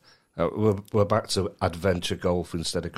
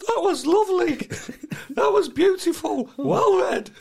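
very high falsetto congratulations; piercingly high, over-the-top compliments; gushing mock praise; 4 dB louder than usual. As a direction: squeal out exaggerated approval. Mock praise after the impression.

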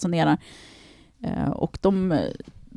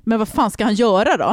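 louder and higher, throwing up her hands; exasperated retort; 5 semitones higher, 5 dB louder than usual. Real, not acted. Defending the rescuer to her co-host, loud and exasperated. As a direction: push back hard on the objection.

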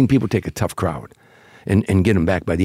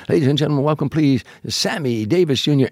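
higher-pitched MC build-up; emcee announcing; 6 semitones higher than usual. Retold or imitated. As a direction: bring on the star like a showman. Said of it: "The M C gets on stage".

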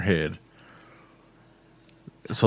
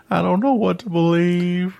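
lightly voiced tearful child, pitched higher; upset little girl; 12 semitones higher, 4 dB louder than usual. Dad mimics his daughter doubting everything, mild tearful child voice. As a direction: mimic a confused, weepy daughter.

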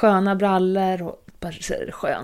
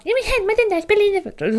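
much higher mocking mimic voice; mocking imitation; 13 semitones higher, faster than usual. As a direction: ape the colleague in a high mocking tone. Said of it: "härmade mig".

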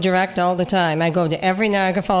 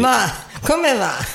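slow, higher playful Italian greeting; Italian accent; 4 semitones higher, slower than usual. Pretend.

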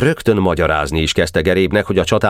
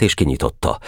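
usual speaking voice

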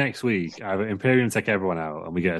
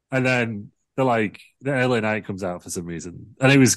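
ordinary talk

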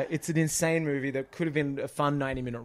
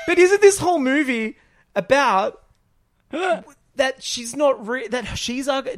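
high, loud, incredulous mock question; replaying his own disbelief at the twist, pitched way up; baffled retort; 10 semitones higher, 7 dB louder than usual. Retelling his confused reaction to the post-credit scene, high incredulous voice. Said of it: "and I'm like".

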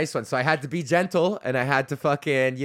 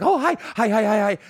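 high, excited yappy greeting like a happy puppy; comic tells the audience they're eager puppies, high yappy voice; eager puppy dog; 8 semitones higher, 5 dB louder than usual. Retold or imitated. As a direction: greet them like a puppy wagging its tail. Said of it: "the audience is like a, like a puppy dog".

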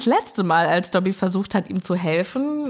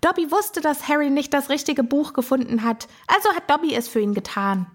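Dobby; 5 semitones higher than usual. Retold or imitated. House-elf explains his help to Harry, high little voice. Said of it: "weil Dobby sagt jetzt".

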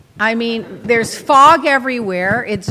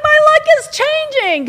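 high, loud gleeful announcement; delighted at the international terminal; 6 dB louder than usual. Retold or imitated. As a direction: sing out that your luck has turned. Happy at the nice terminal.

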